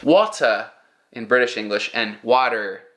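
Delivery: first "Water" British with no R, second "water" American with a hard R; British then American. Shown as a demonstration. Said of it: "difference between British English and American English".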